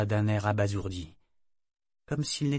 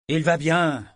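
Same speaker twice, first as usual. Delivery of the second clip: curt and clipped, higher and louder; brusque; 6 semitones higher, 8 dB louder, slower than usual. Acted. Captain cutting off his mate about his son, brusque. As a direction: snap it off to end the matter. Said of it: "dit-il d'un ton brusque".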